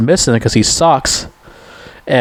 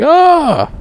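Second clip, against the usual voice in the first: mock scream. Cops open fire, Rambo screams high. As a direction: imitate Rambo yelling as they shoot. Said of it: "and he's like".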